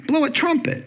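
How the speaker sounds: high mock trumpet call; trumpet imitation. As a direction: toot it like a herald's horn. Mimicking trumpet blast.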